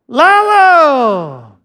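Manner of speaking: long loud call across the room. Real, not acted. Pastor calling his friend up front, loud long call. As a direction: shout his name so he hears you at the back.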